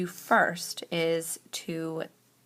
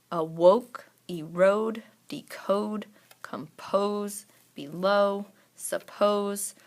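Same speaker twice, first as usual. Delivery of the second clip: second syllable punched and stretched in awoke, erode, decode; emphasized long O syllable; 3 semitones higher, 3 dB louder, much slower than usual. Shown as a demonstration. Teacher checking second syllable words, second syllable of each emphasized. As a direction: hit the long O at the end, as in remote. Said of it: "really gonna emphasize the syllable".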